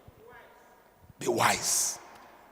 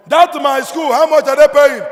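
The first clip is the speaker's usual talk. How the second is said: loud, higher, pressing boast; father crowing over his son's earnings; boastful father; 6 semitones higher, 13 dB louder than usual. Retold or imitated. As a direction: push hard, almost crowing. Father boasting about son's money.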